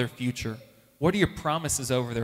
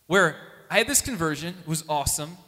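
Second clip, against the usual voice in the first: normal talking voice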